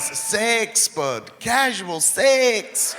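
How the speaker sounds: high, stretched Scottish "sex"; exaggerated Scottish accent on "sex"; Scottish way of saying sex. Shown as a demonstration. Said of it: "That's how they say sex".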